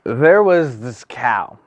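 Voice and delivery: booming mock fairy-tale narrator; storybook narration. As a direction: tell it like a bedtime tale to a big room. Tells a fairy-tale style story.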